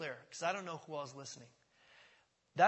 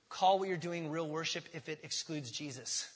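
normal talking voice

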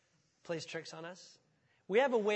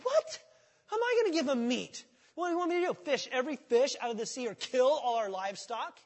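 much higher, loud, flustered disbelief; panicked Moses; 6 semitones higher, 6 dB louder than usual. Retold or imitated. Moses to God, panicked high disbelief. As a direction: squeak out the shock then sputter.

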